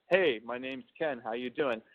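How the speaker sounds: composed, direct self-introduction; professional voice. Shown as a demonstration. Man demonstrating how he introduces himself at work, professional. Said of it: "my professional voice".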